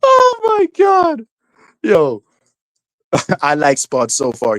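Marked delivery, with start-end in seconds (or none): 0.0-1.2 high, drawn-out squeal of hilarity; cracking up. Real, not acted. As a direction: squeal it slowly, overcome by laughter. Cracking up at own impression.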